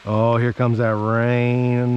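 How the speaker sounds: usual speaking voice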